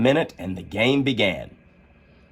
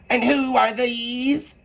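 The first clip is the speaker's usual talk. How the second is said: high, slow Queen; Queen pointing at gardeners, high and slow; much slower than usual. A narrator's reading. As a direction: drag it out as the Queen. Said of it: "said the Queen".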